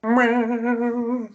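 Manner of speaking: high mocking meow; cat meow. Mock cat sound.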